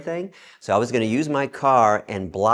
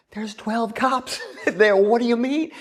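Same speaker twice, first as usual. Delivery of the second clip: very high, squeaky disbelief; mock-panicked high-pitched objection; incredulous protest; 13 semitones higher, 3 dB louder than usual. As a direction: protest in a high, drawn-out voice with a laugh. Incredulous reply to friend's urging.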